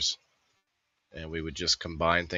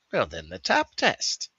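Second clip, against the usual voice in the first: playful mock; 8 semitones higher, 4 dB louder than usual. Teasing tap test.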